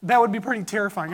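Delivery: ordinary talk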